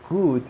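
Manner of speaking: GOOD with tight long "oo"; GOOD with strong lips. Shown as a demonstration. Teacher demonstrating the error, GOOD with tense lips. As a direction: push the lips firm and round on GOOD. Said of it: "my lips are not relaxed".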